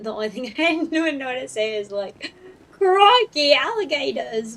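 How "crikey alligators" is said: high, loud mock Australian exclamation; hammed-up Aussie, slipping toward British; attempted Australian accent. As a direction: burst out in a bad Australian accent. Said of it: "I feel like if I were Australian".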